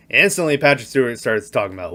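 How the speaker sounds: ordinary talk, nothing marked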